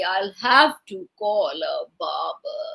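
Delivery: slow, low, theatrical lament; worried king, demonstrated for children to copy. Shown as a demonstration. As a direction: drag it out in a soft mock-worried voice. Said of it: "in a worried way".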